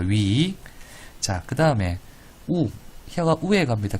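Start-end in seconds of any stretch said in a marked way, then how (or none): 3.1-4.0 Gyeongsang "우에" for "위에", pitched higher; higher-pitched Gyeongsang dialect mnemonic. Shown as a demonstration. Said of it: "경상도 사투리로".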